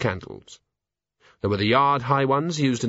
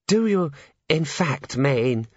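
fussy clerk voice; 4 semitones higher, slower than usual. A narrator's reading. Drumknot voiced.

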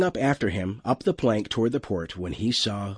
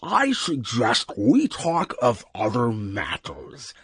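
slow, put-on creature voice; talking alien wub; slower than usual. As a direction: suggest it slowly, as a dignified beast.

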